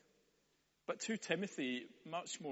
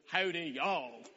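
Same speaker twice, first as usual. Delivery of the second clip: hammed-up Texan greeting, louder and drawn out; Texan accent; 3 semitones higher, 6 dB louder, slower than usual. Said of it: "whether he actually talks like that".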